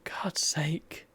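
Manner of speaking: whispered annoyance; exasperated whisper. Real, not acted. Gamer muttering at another interruption, whispered exasperation. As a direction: hiss it under your breath.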